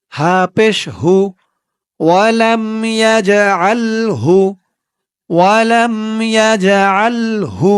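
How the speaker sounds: drawn-out recited syllables; slow recitation. Shown as a demonstration. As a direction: lengthen each syllable, recitation tune. (ধীরে টেনে পড়া)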